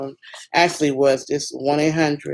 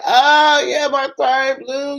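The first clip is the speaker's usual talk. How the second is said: very high, shaky, breathless relief; hysterical; 10 semitones higher, much slower than usual. Retold or imitated. Stranded mother answering the cowboy who stopped, very high and hysterical. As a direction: gasp it out near tears. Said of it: "I was hysterical".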